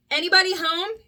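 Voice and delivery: mild calling out to the house. Acted. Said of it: "Jiminy called out".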